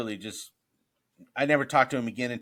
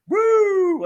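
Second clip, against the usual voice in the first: high, loud siren whoop; imitated police siren; 10 dB louder than usual. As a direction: whoop like a squad car pulling up.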